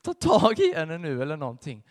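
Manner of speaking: loud, frustrated hiss-free outburst from outside; impatient anger while keeping watch; retold anger. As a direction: urge him on angrily. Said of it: "stod jag i min ilska".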